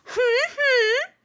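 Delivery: very high, loud throat-clearing hum; embarrassed king clearing his throat; royal hem. Acted. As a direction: hem pompously. King hems.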